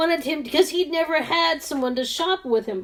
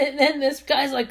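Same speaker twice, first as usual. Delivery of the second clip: usual speaking voice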